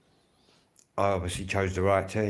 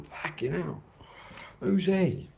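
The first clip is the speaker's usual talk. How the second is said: puzzled local fan; 8 semitones higher, 4 dB quieter, slower than usual. Retold or imitated. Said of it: "they'll all be looking at me thinking".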